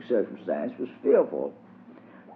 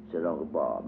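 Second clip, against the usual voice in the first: ordinary delivery, nothing marked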